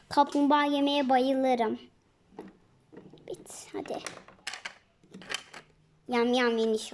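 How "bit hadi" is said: hushed secret whisper; whispered plan between friends. Acted. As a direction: whisper so the others can't hear. Quiet urging to partner.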